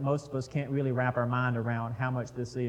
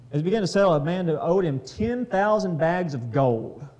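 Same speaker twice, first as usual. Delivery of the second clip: louder, steady Bible reading voice; scripture reading; 2 semitones higher, 4 dB louder than usual. Acted. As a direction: read the passage out to the group. Reads the parable aloud.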